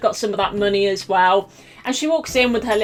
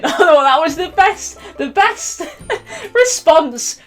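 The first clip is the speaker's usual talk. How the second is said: laughing; 7 semitones higher, 3 dB louder, slower than usual. Real, not acted. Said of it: "laughing while telling".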